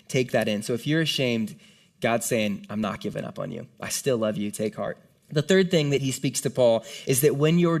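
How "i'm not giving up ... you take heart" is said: tender voice of God. Acted. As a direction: lower and softer, gently reassuring. God's reassuring words to the ashamed.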